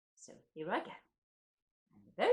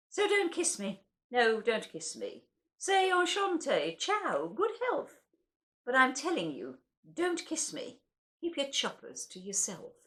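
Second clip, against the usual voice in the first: lively, animated comic verse reading; comic poem recital; 6 semitones higher, 4 dB louder, slower than usual. Acted. Reciting Pam Ayres' poem to an audience, playful comic delivery. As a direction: perform it with bouncy comic timing.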